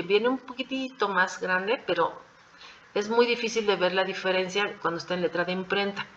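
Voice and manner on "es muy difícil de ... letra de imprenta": normal talking voice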